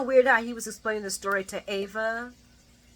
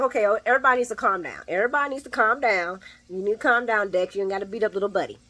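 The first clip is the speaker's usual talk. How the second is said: rushed peacemaker; faster than usual. Retold or imitated. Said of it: "Josh is like".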